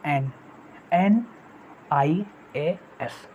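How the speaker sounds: "आई ए एस" letter by letter, very slowly; slow spelling. Shown as a demonstration. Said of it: "ये भी क्या लगा दोगे दोस्तों".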